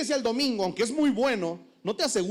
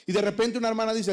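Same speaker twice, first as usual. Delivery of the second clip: usual speaking voice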